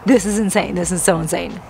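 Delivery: normal talking voice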